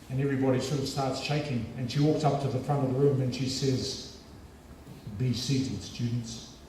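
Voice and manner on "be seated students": softly voiced, restrained lecturer; intimidating lecturer. Feared lecturer seating her medical students, quietly intimidating. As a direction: lightly play a feared teacher, keeping the volume down. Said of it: "the most intimidating, feared lecturer".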